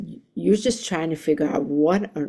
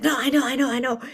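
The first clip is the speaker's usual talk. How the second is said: frustrated; 7 semitones higher, much faster than usual. Retold or imitated. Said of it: "he was so frustrated".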